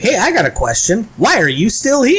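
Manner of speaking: high, rising cartoon voice; imitating a smug cartoon playboy; Quagmire impression. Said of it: "Like Quagmire in the, in the morning".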